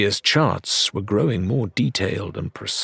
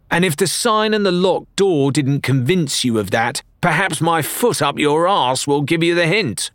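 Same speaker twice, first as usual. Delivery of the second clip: higher, louder tart tavern woman; tart barmaid; 8 semitones higher, 3 dB louder than usual. A narrator's reading. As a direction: threaten him with a sharp tongue. Annoyed barmaid threatens intruder.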